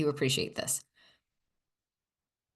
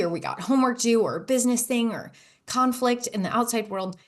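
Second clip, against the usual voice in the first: ordinary talk, nothing marked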